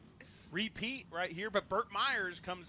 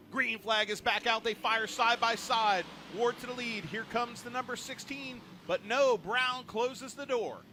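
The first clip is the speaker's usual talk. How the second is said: rising, louder race call; excited play-by-play; 7 semitones higher, 5 dB louder than usual. Real, not acted. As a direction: call the restart with building excitement. Live race call on restart.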